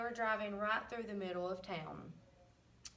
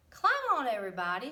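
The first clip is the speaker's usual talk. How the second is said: shouted; 3 semitones higher, 7 dB louder, much faster than usual. A narrator's reading. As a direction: shout it out to everyone climbing on. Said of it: "shouted Duck".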